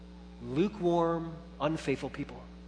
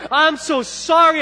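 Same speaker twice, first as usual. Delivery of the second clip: higher, louder, slow apologetic; mock apology; 10 semitones higher, 9 dB louder than usual. Dad apologizing to his son, mock remorseful. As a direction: say sorry to the baby, hammed-up. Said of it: "I'm like".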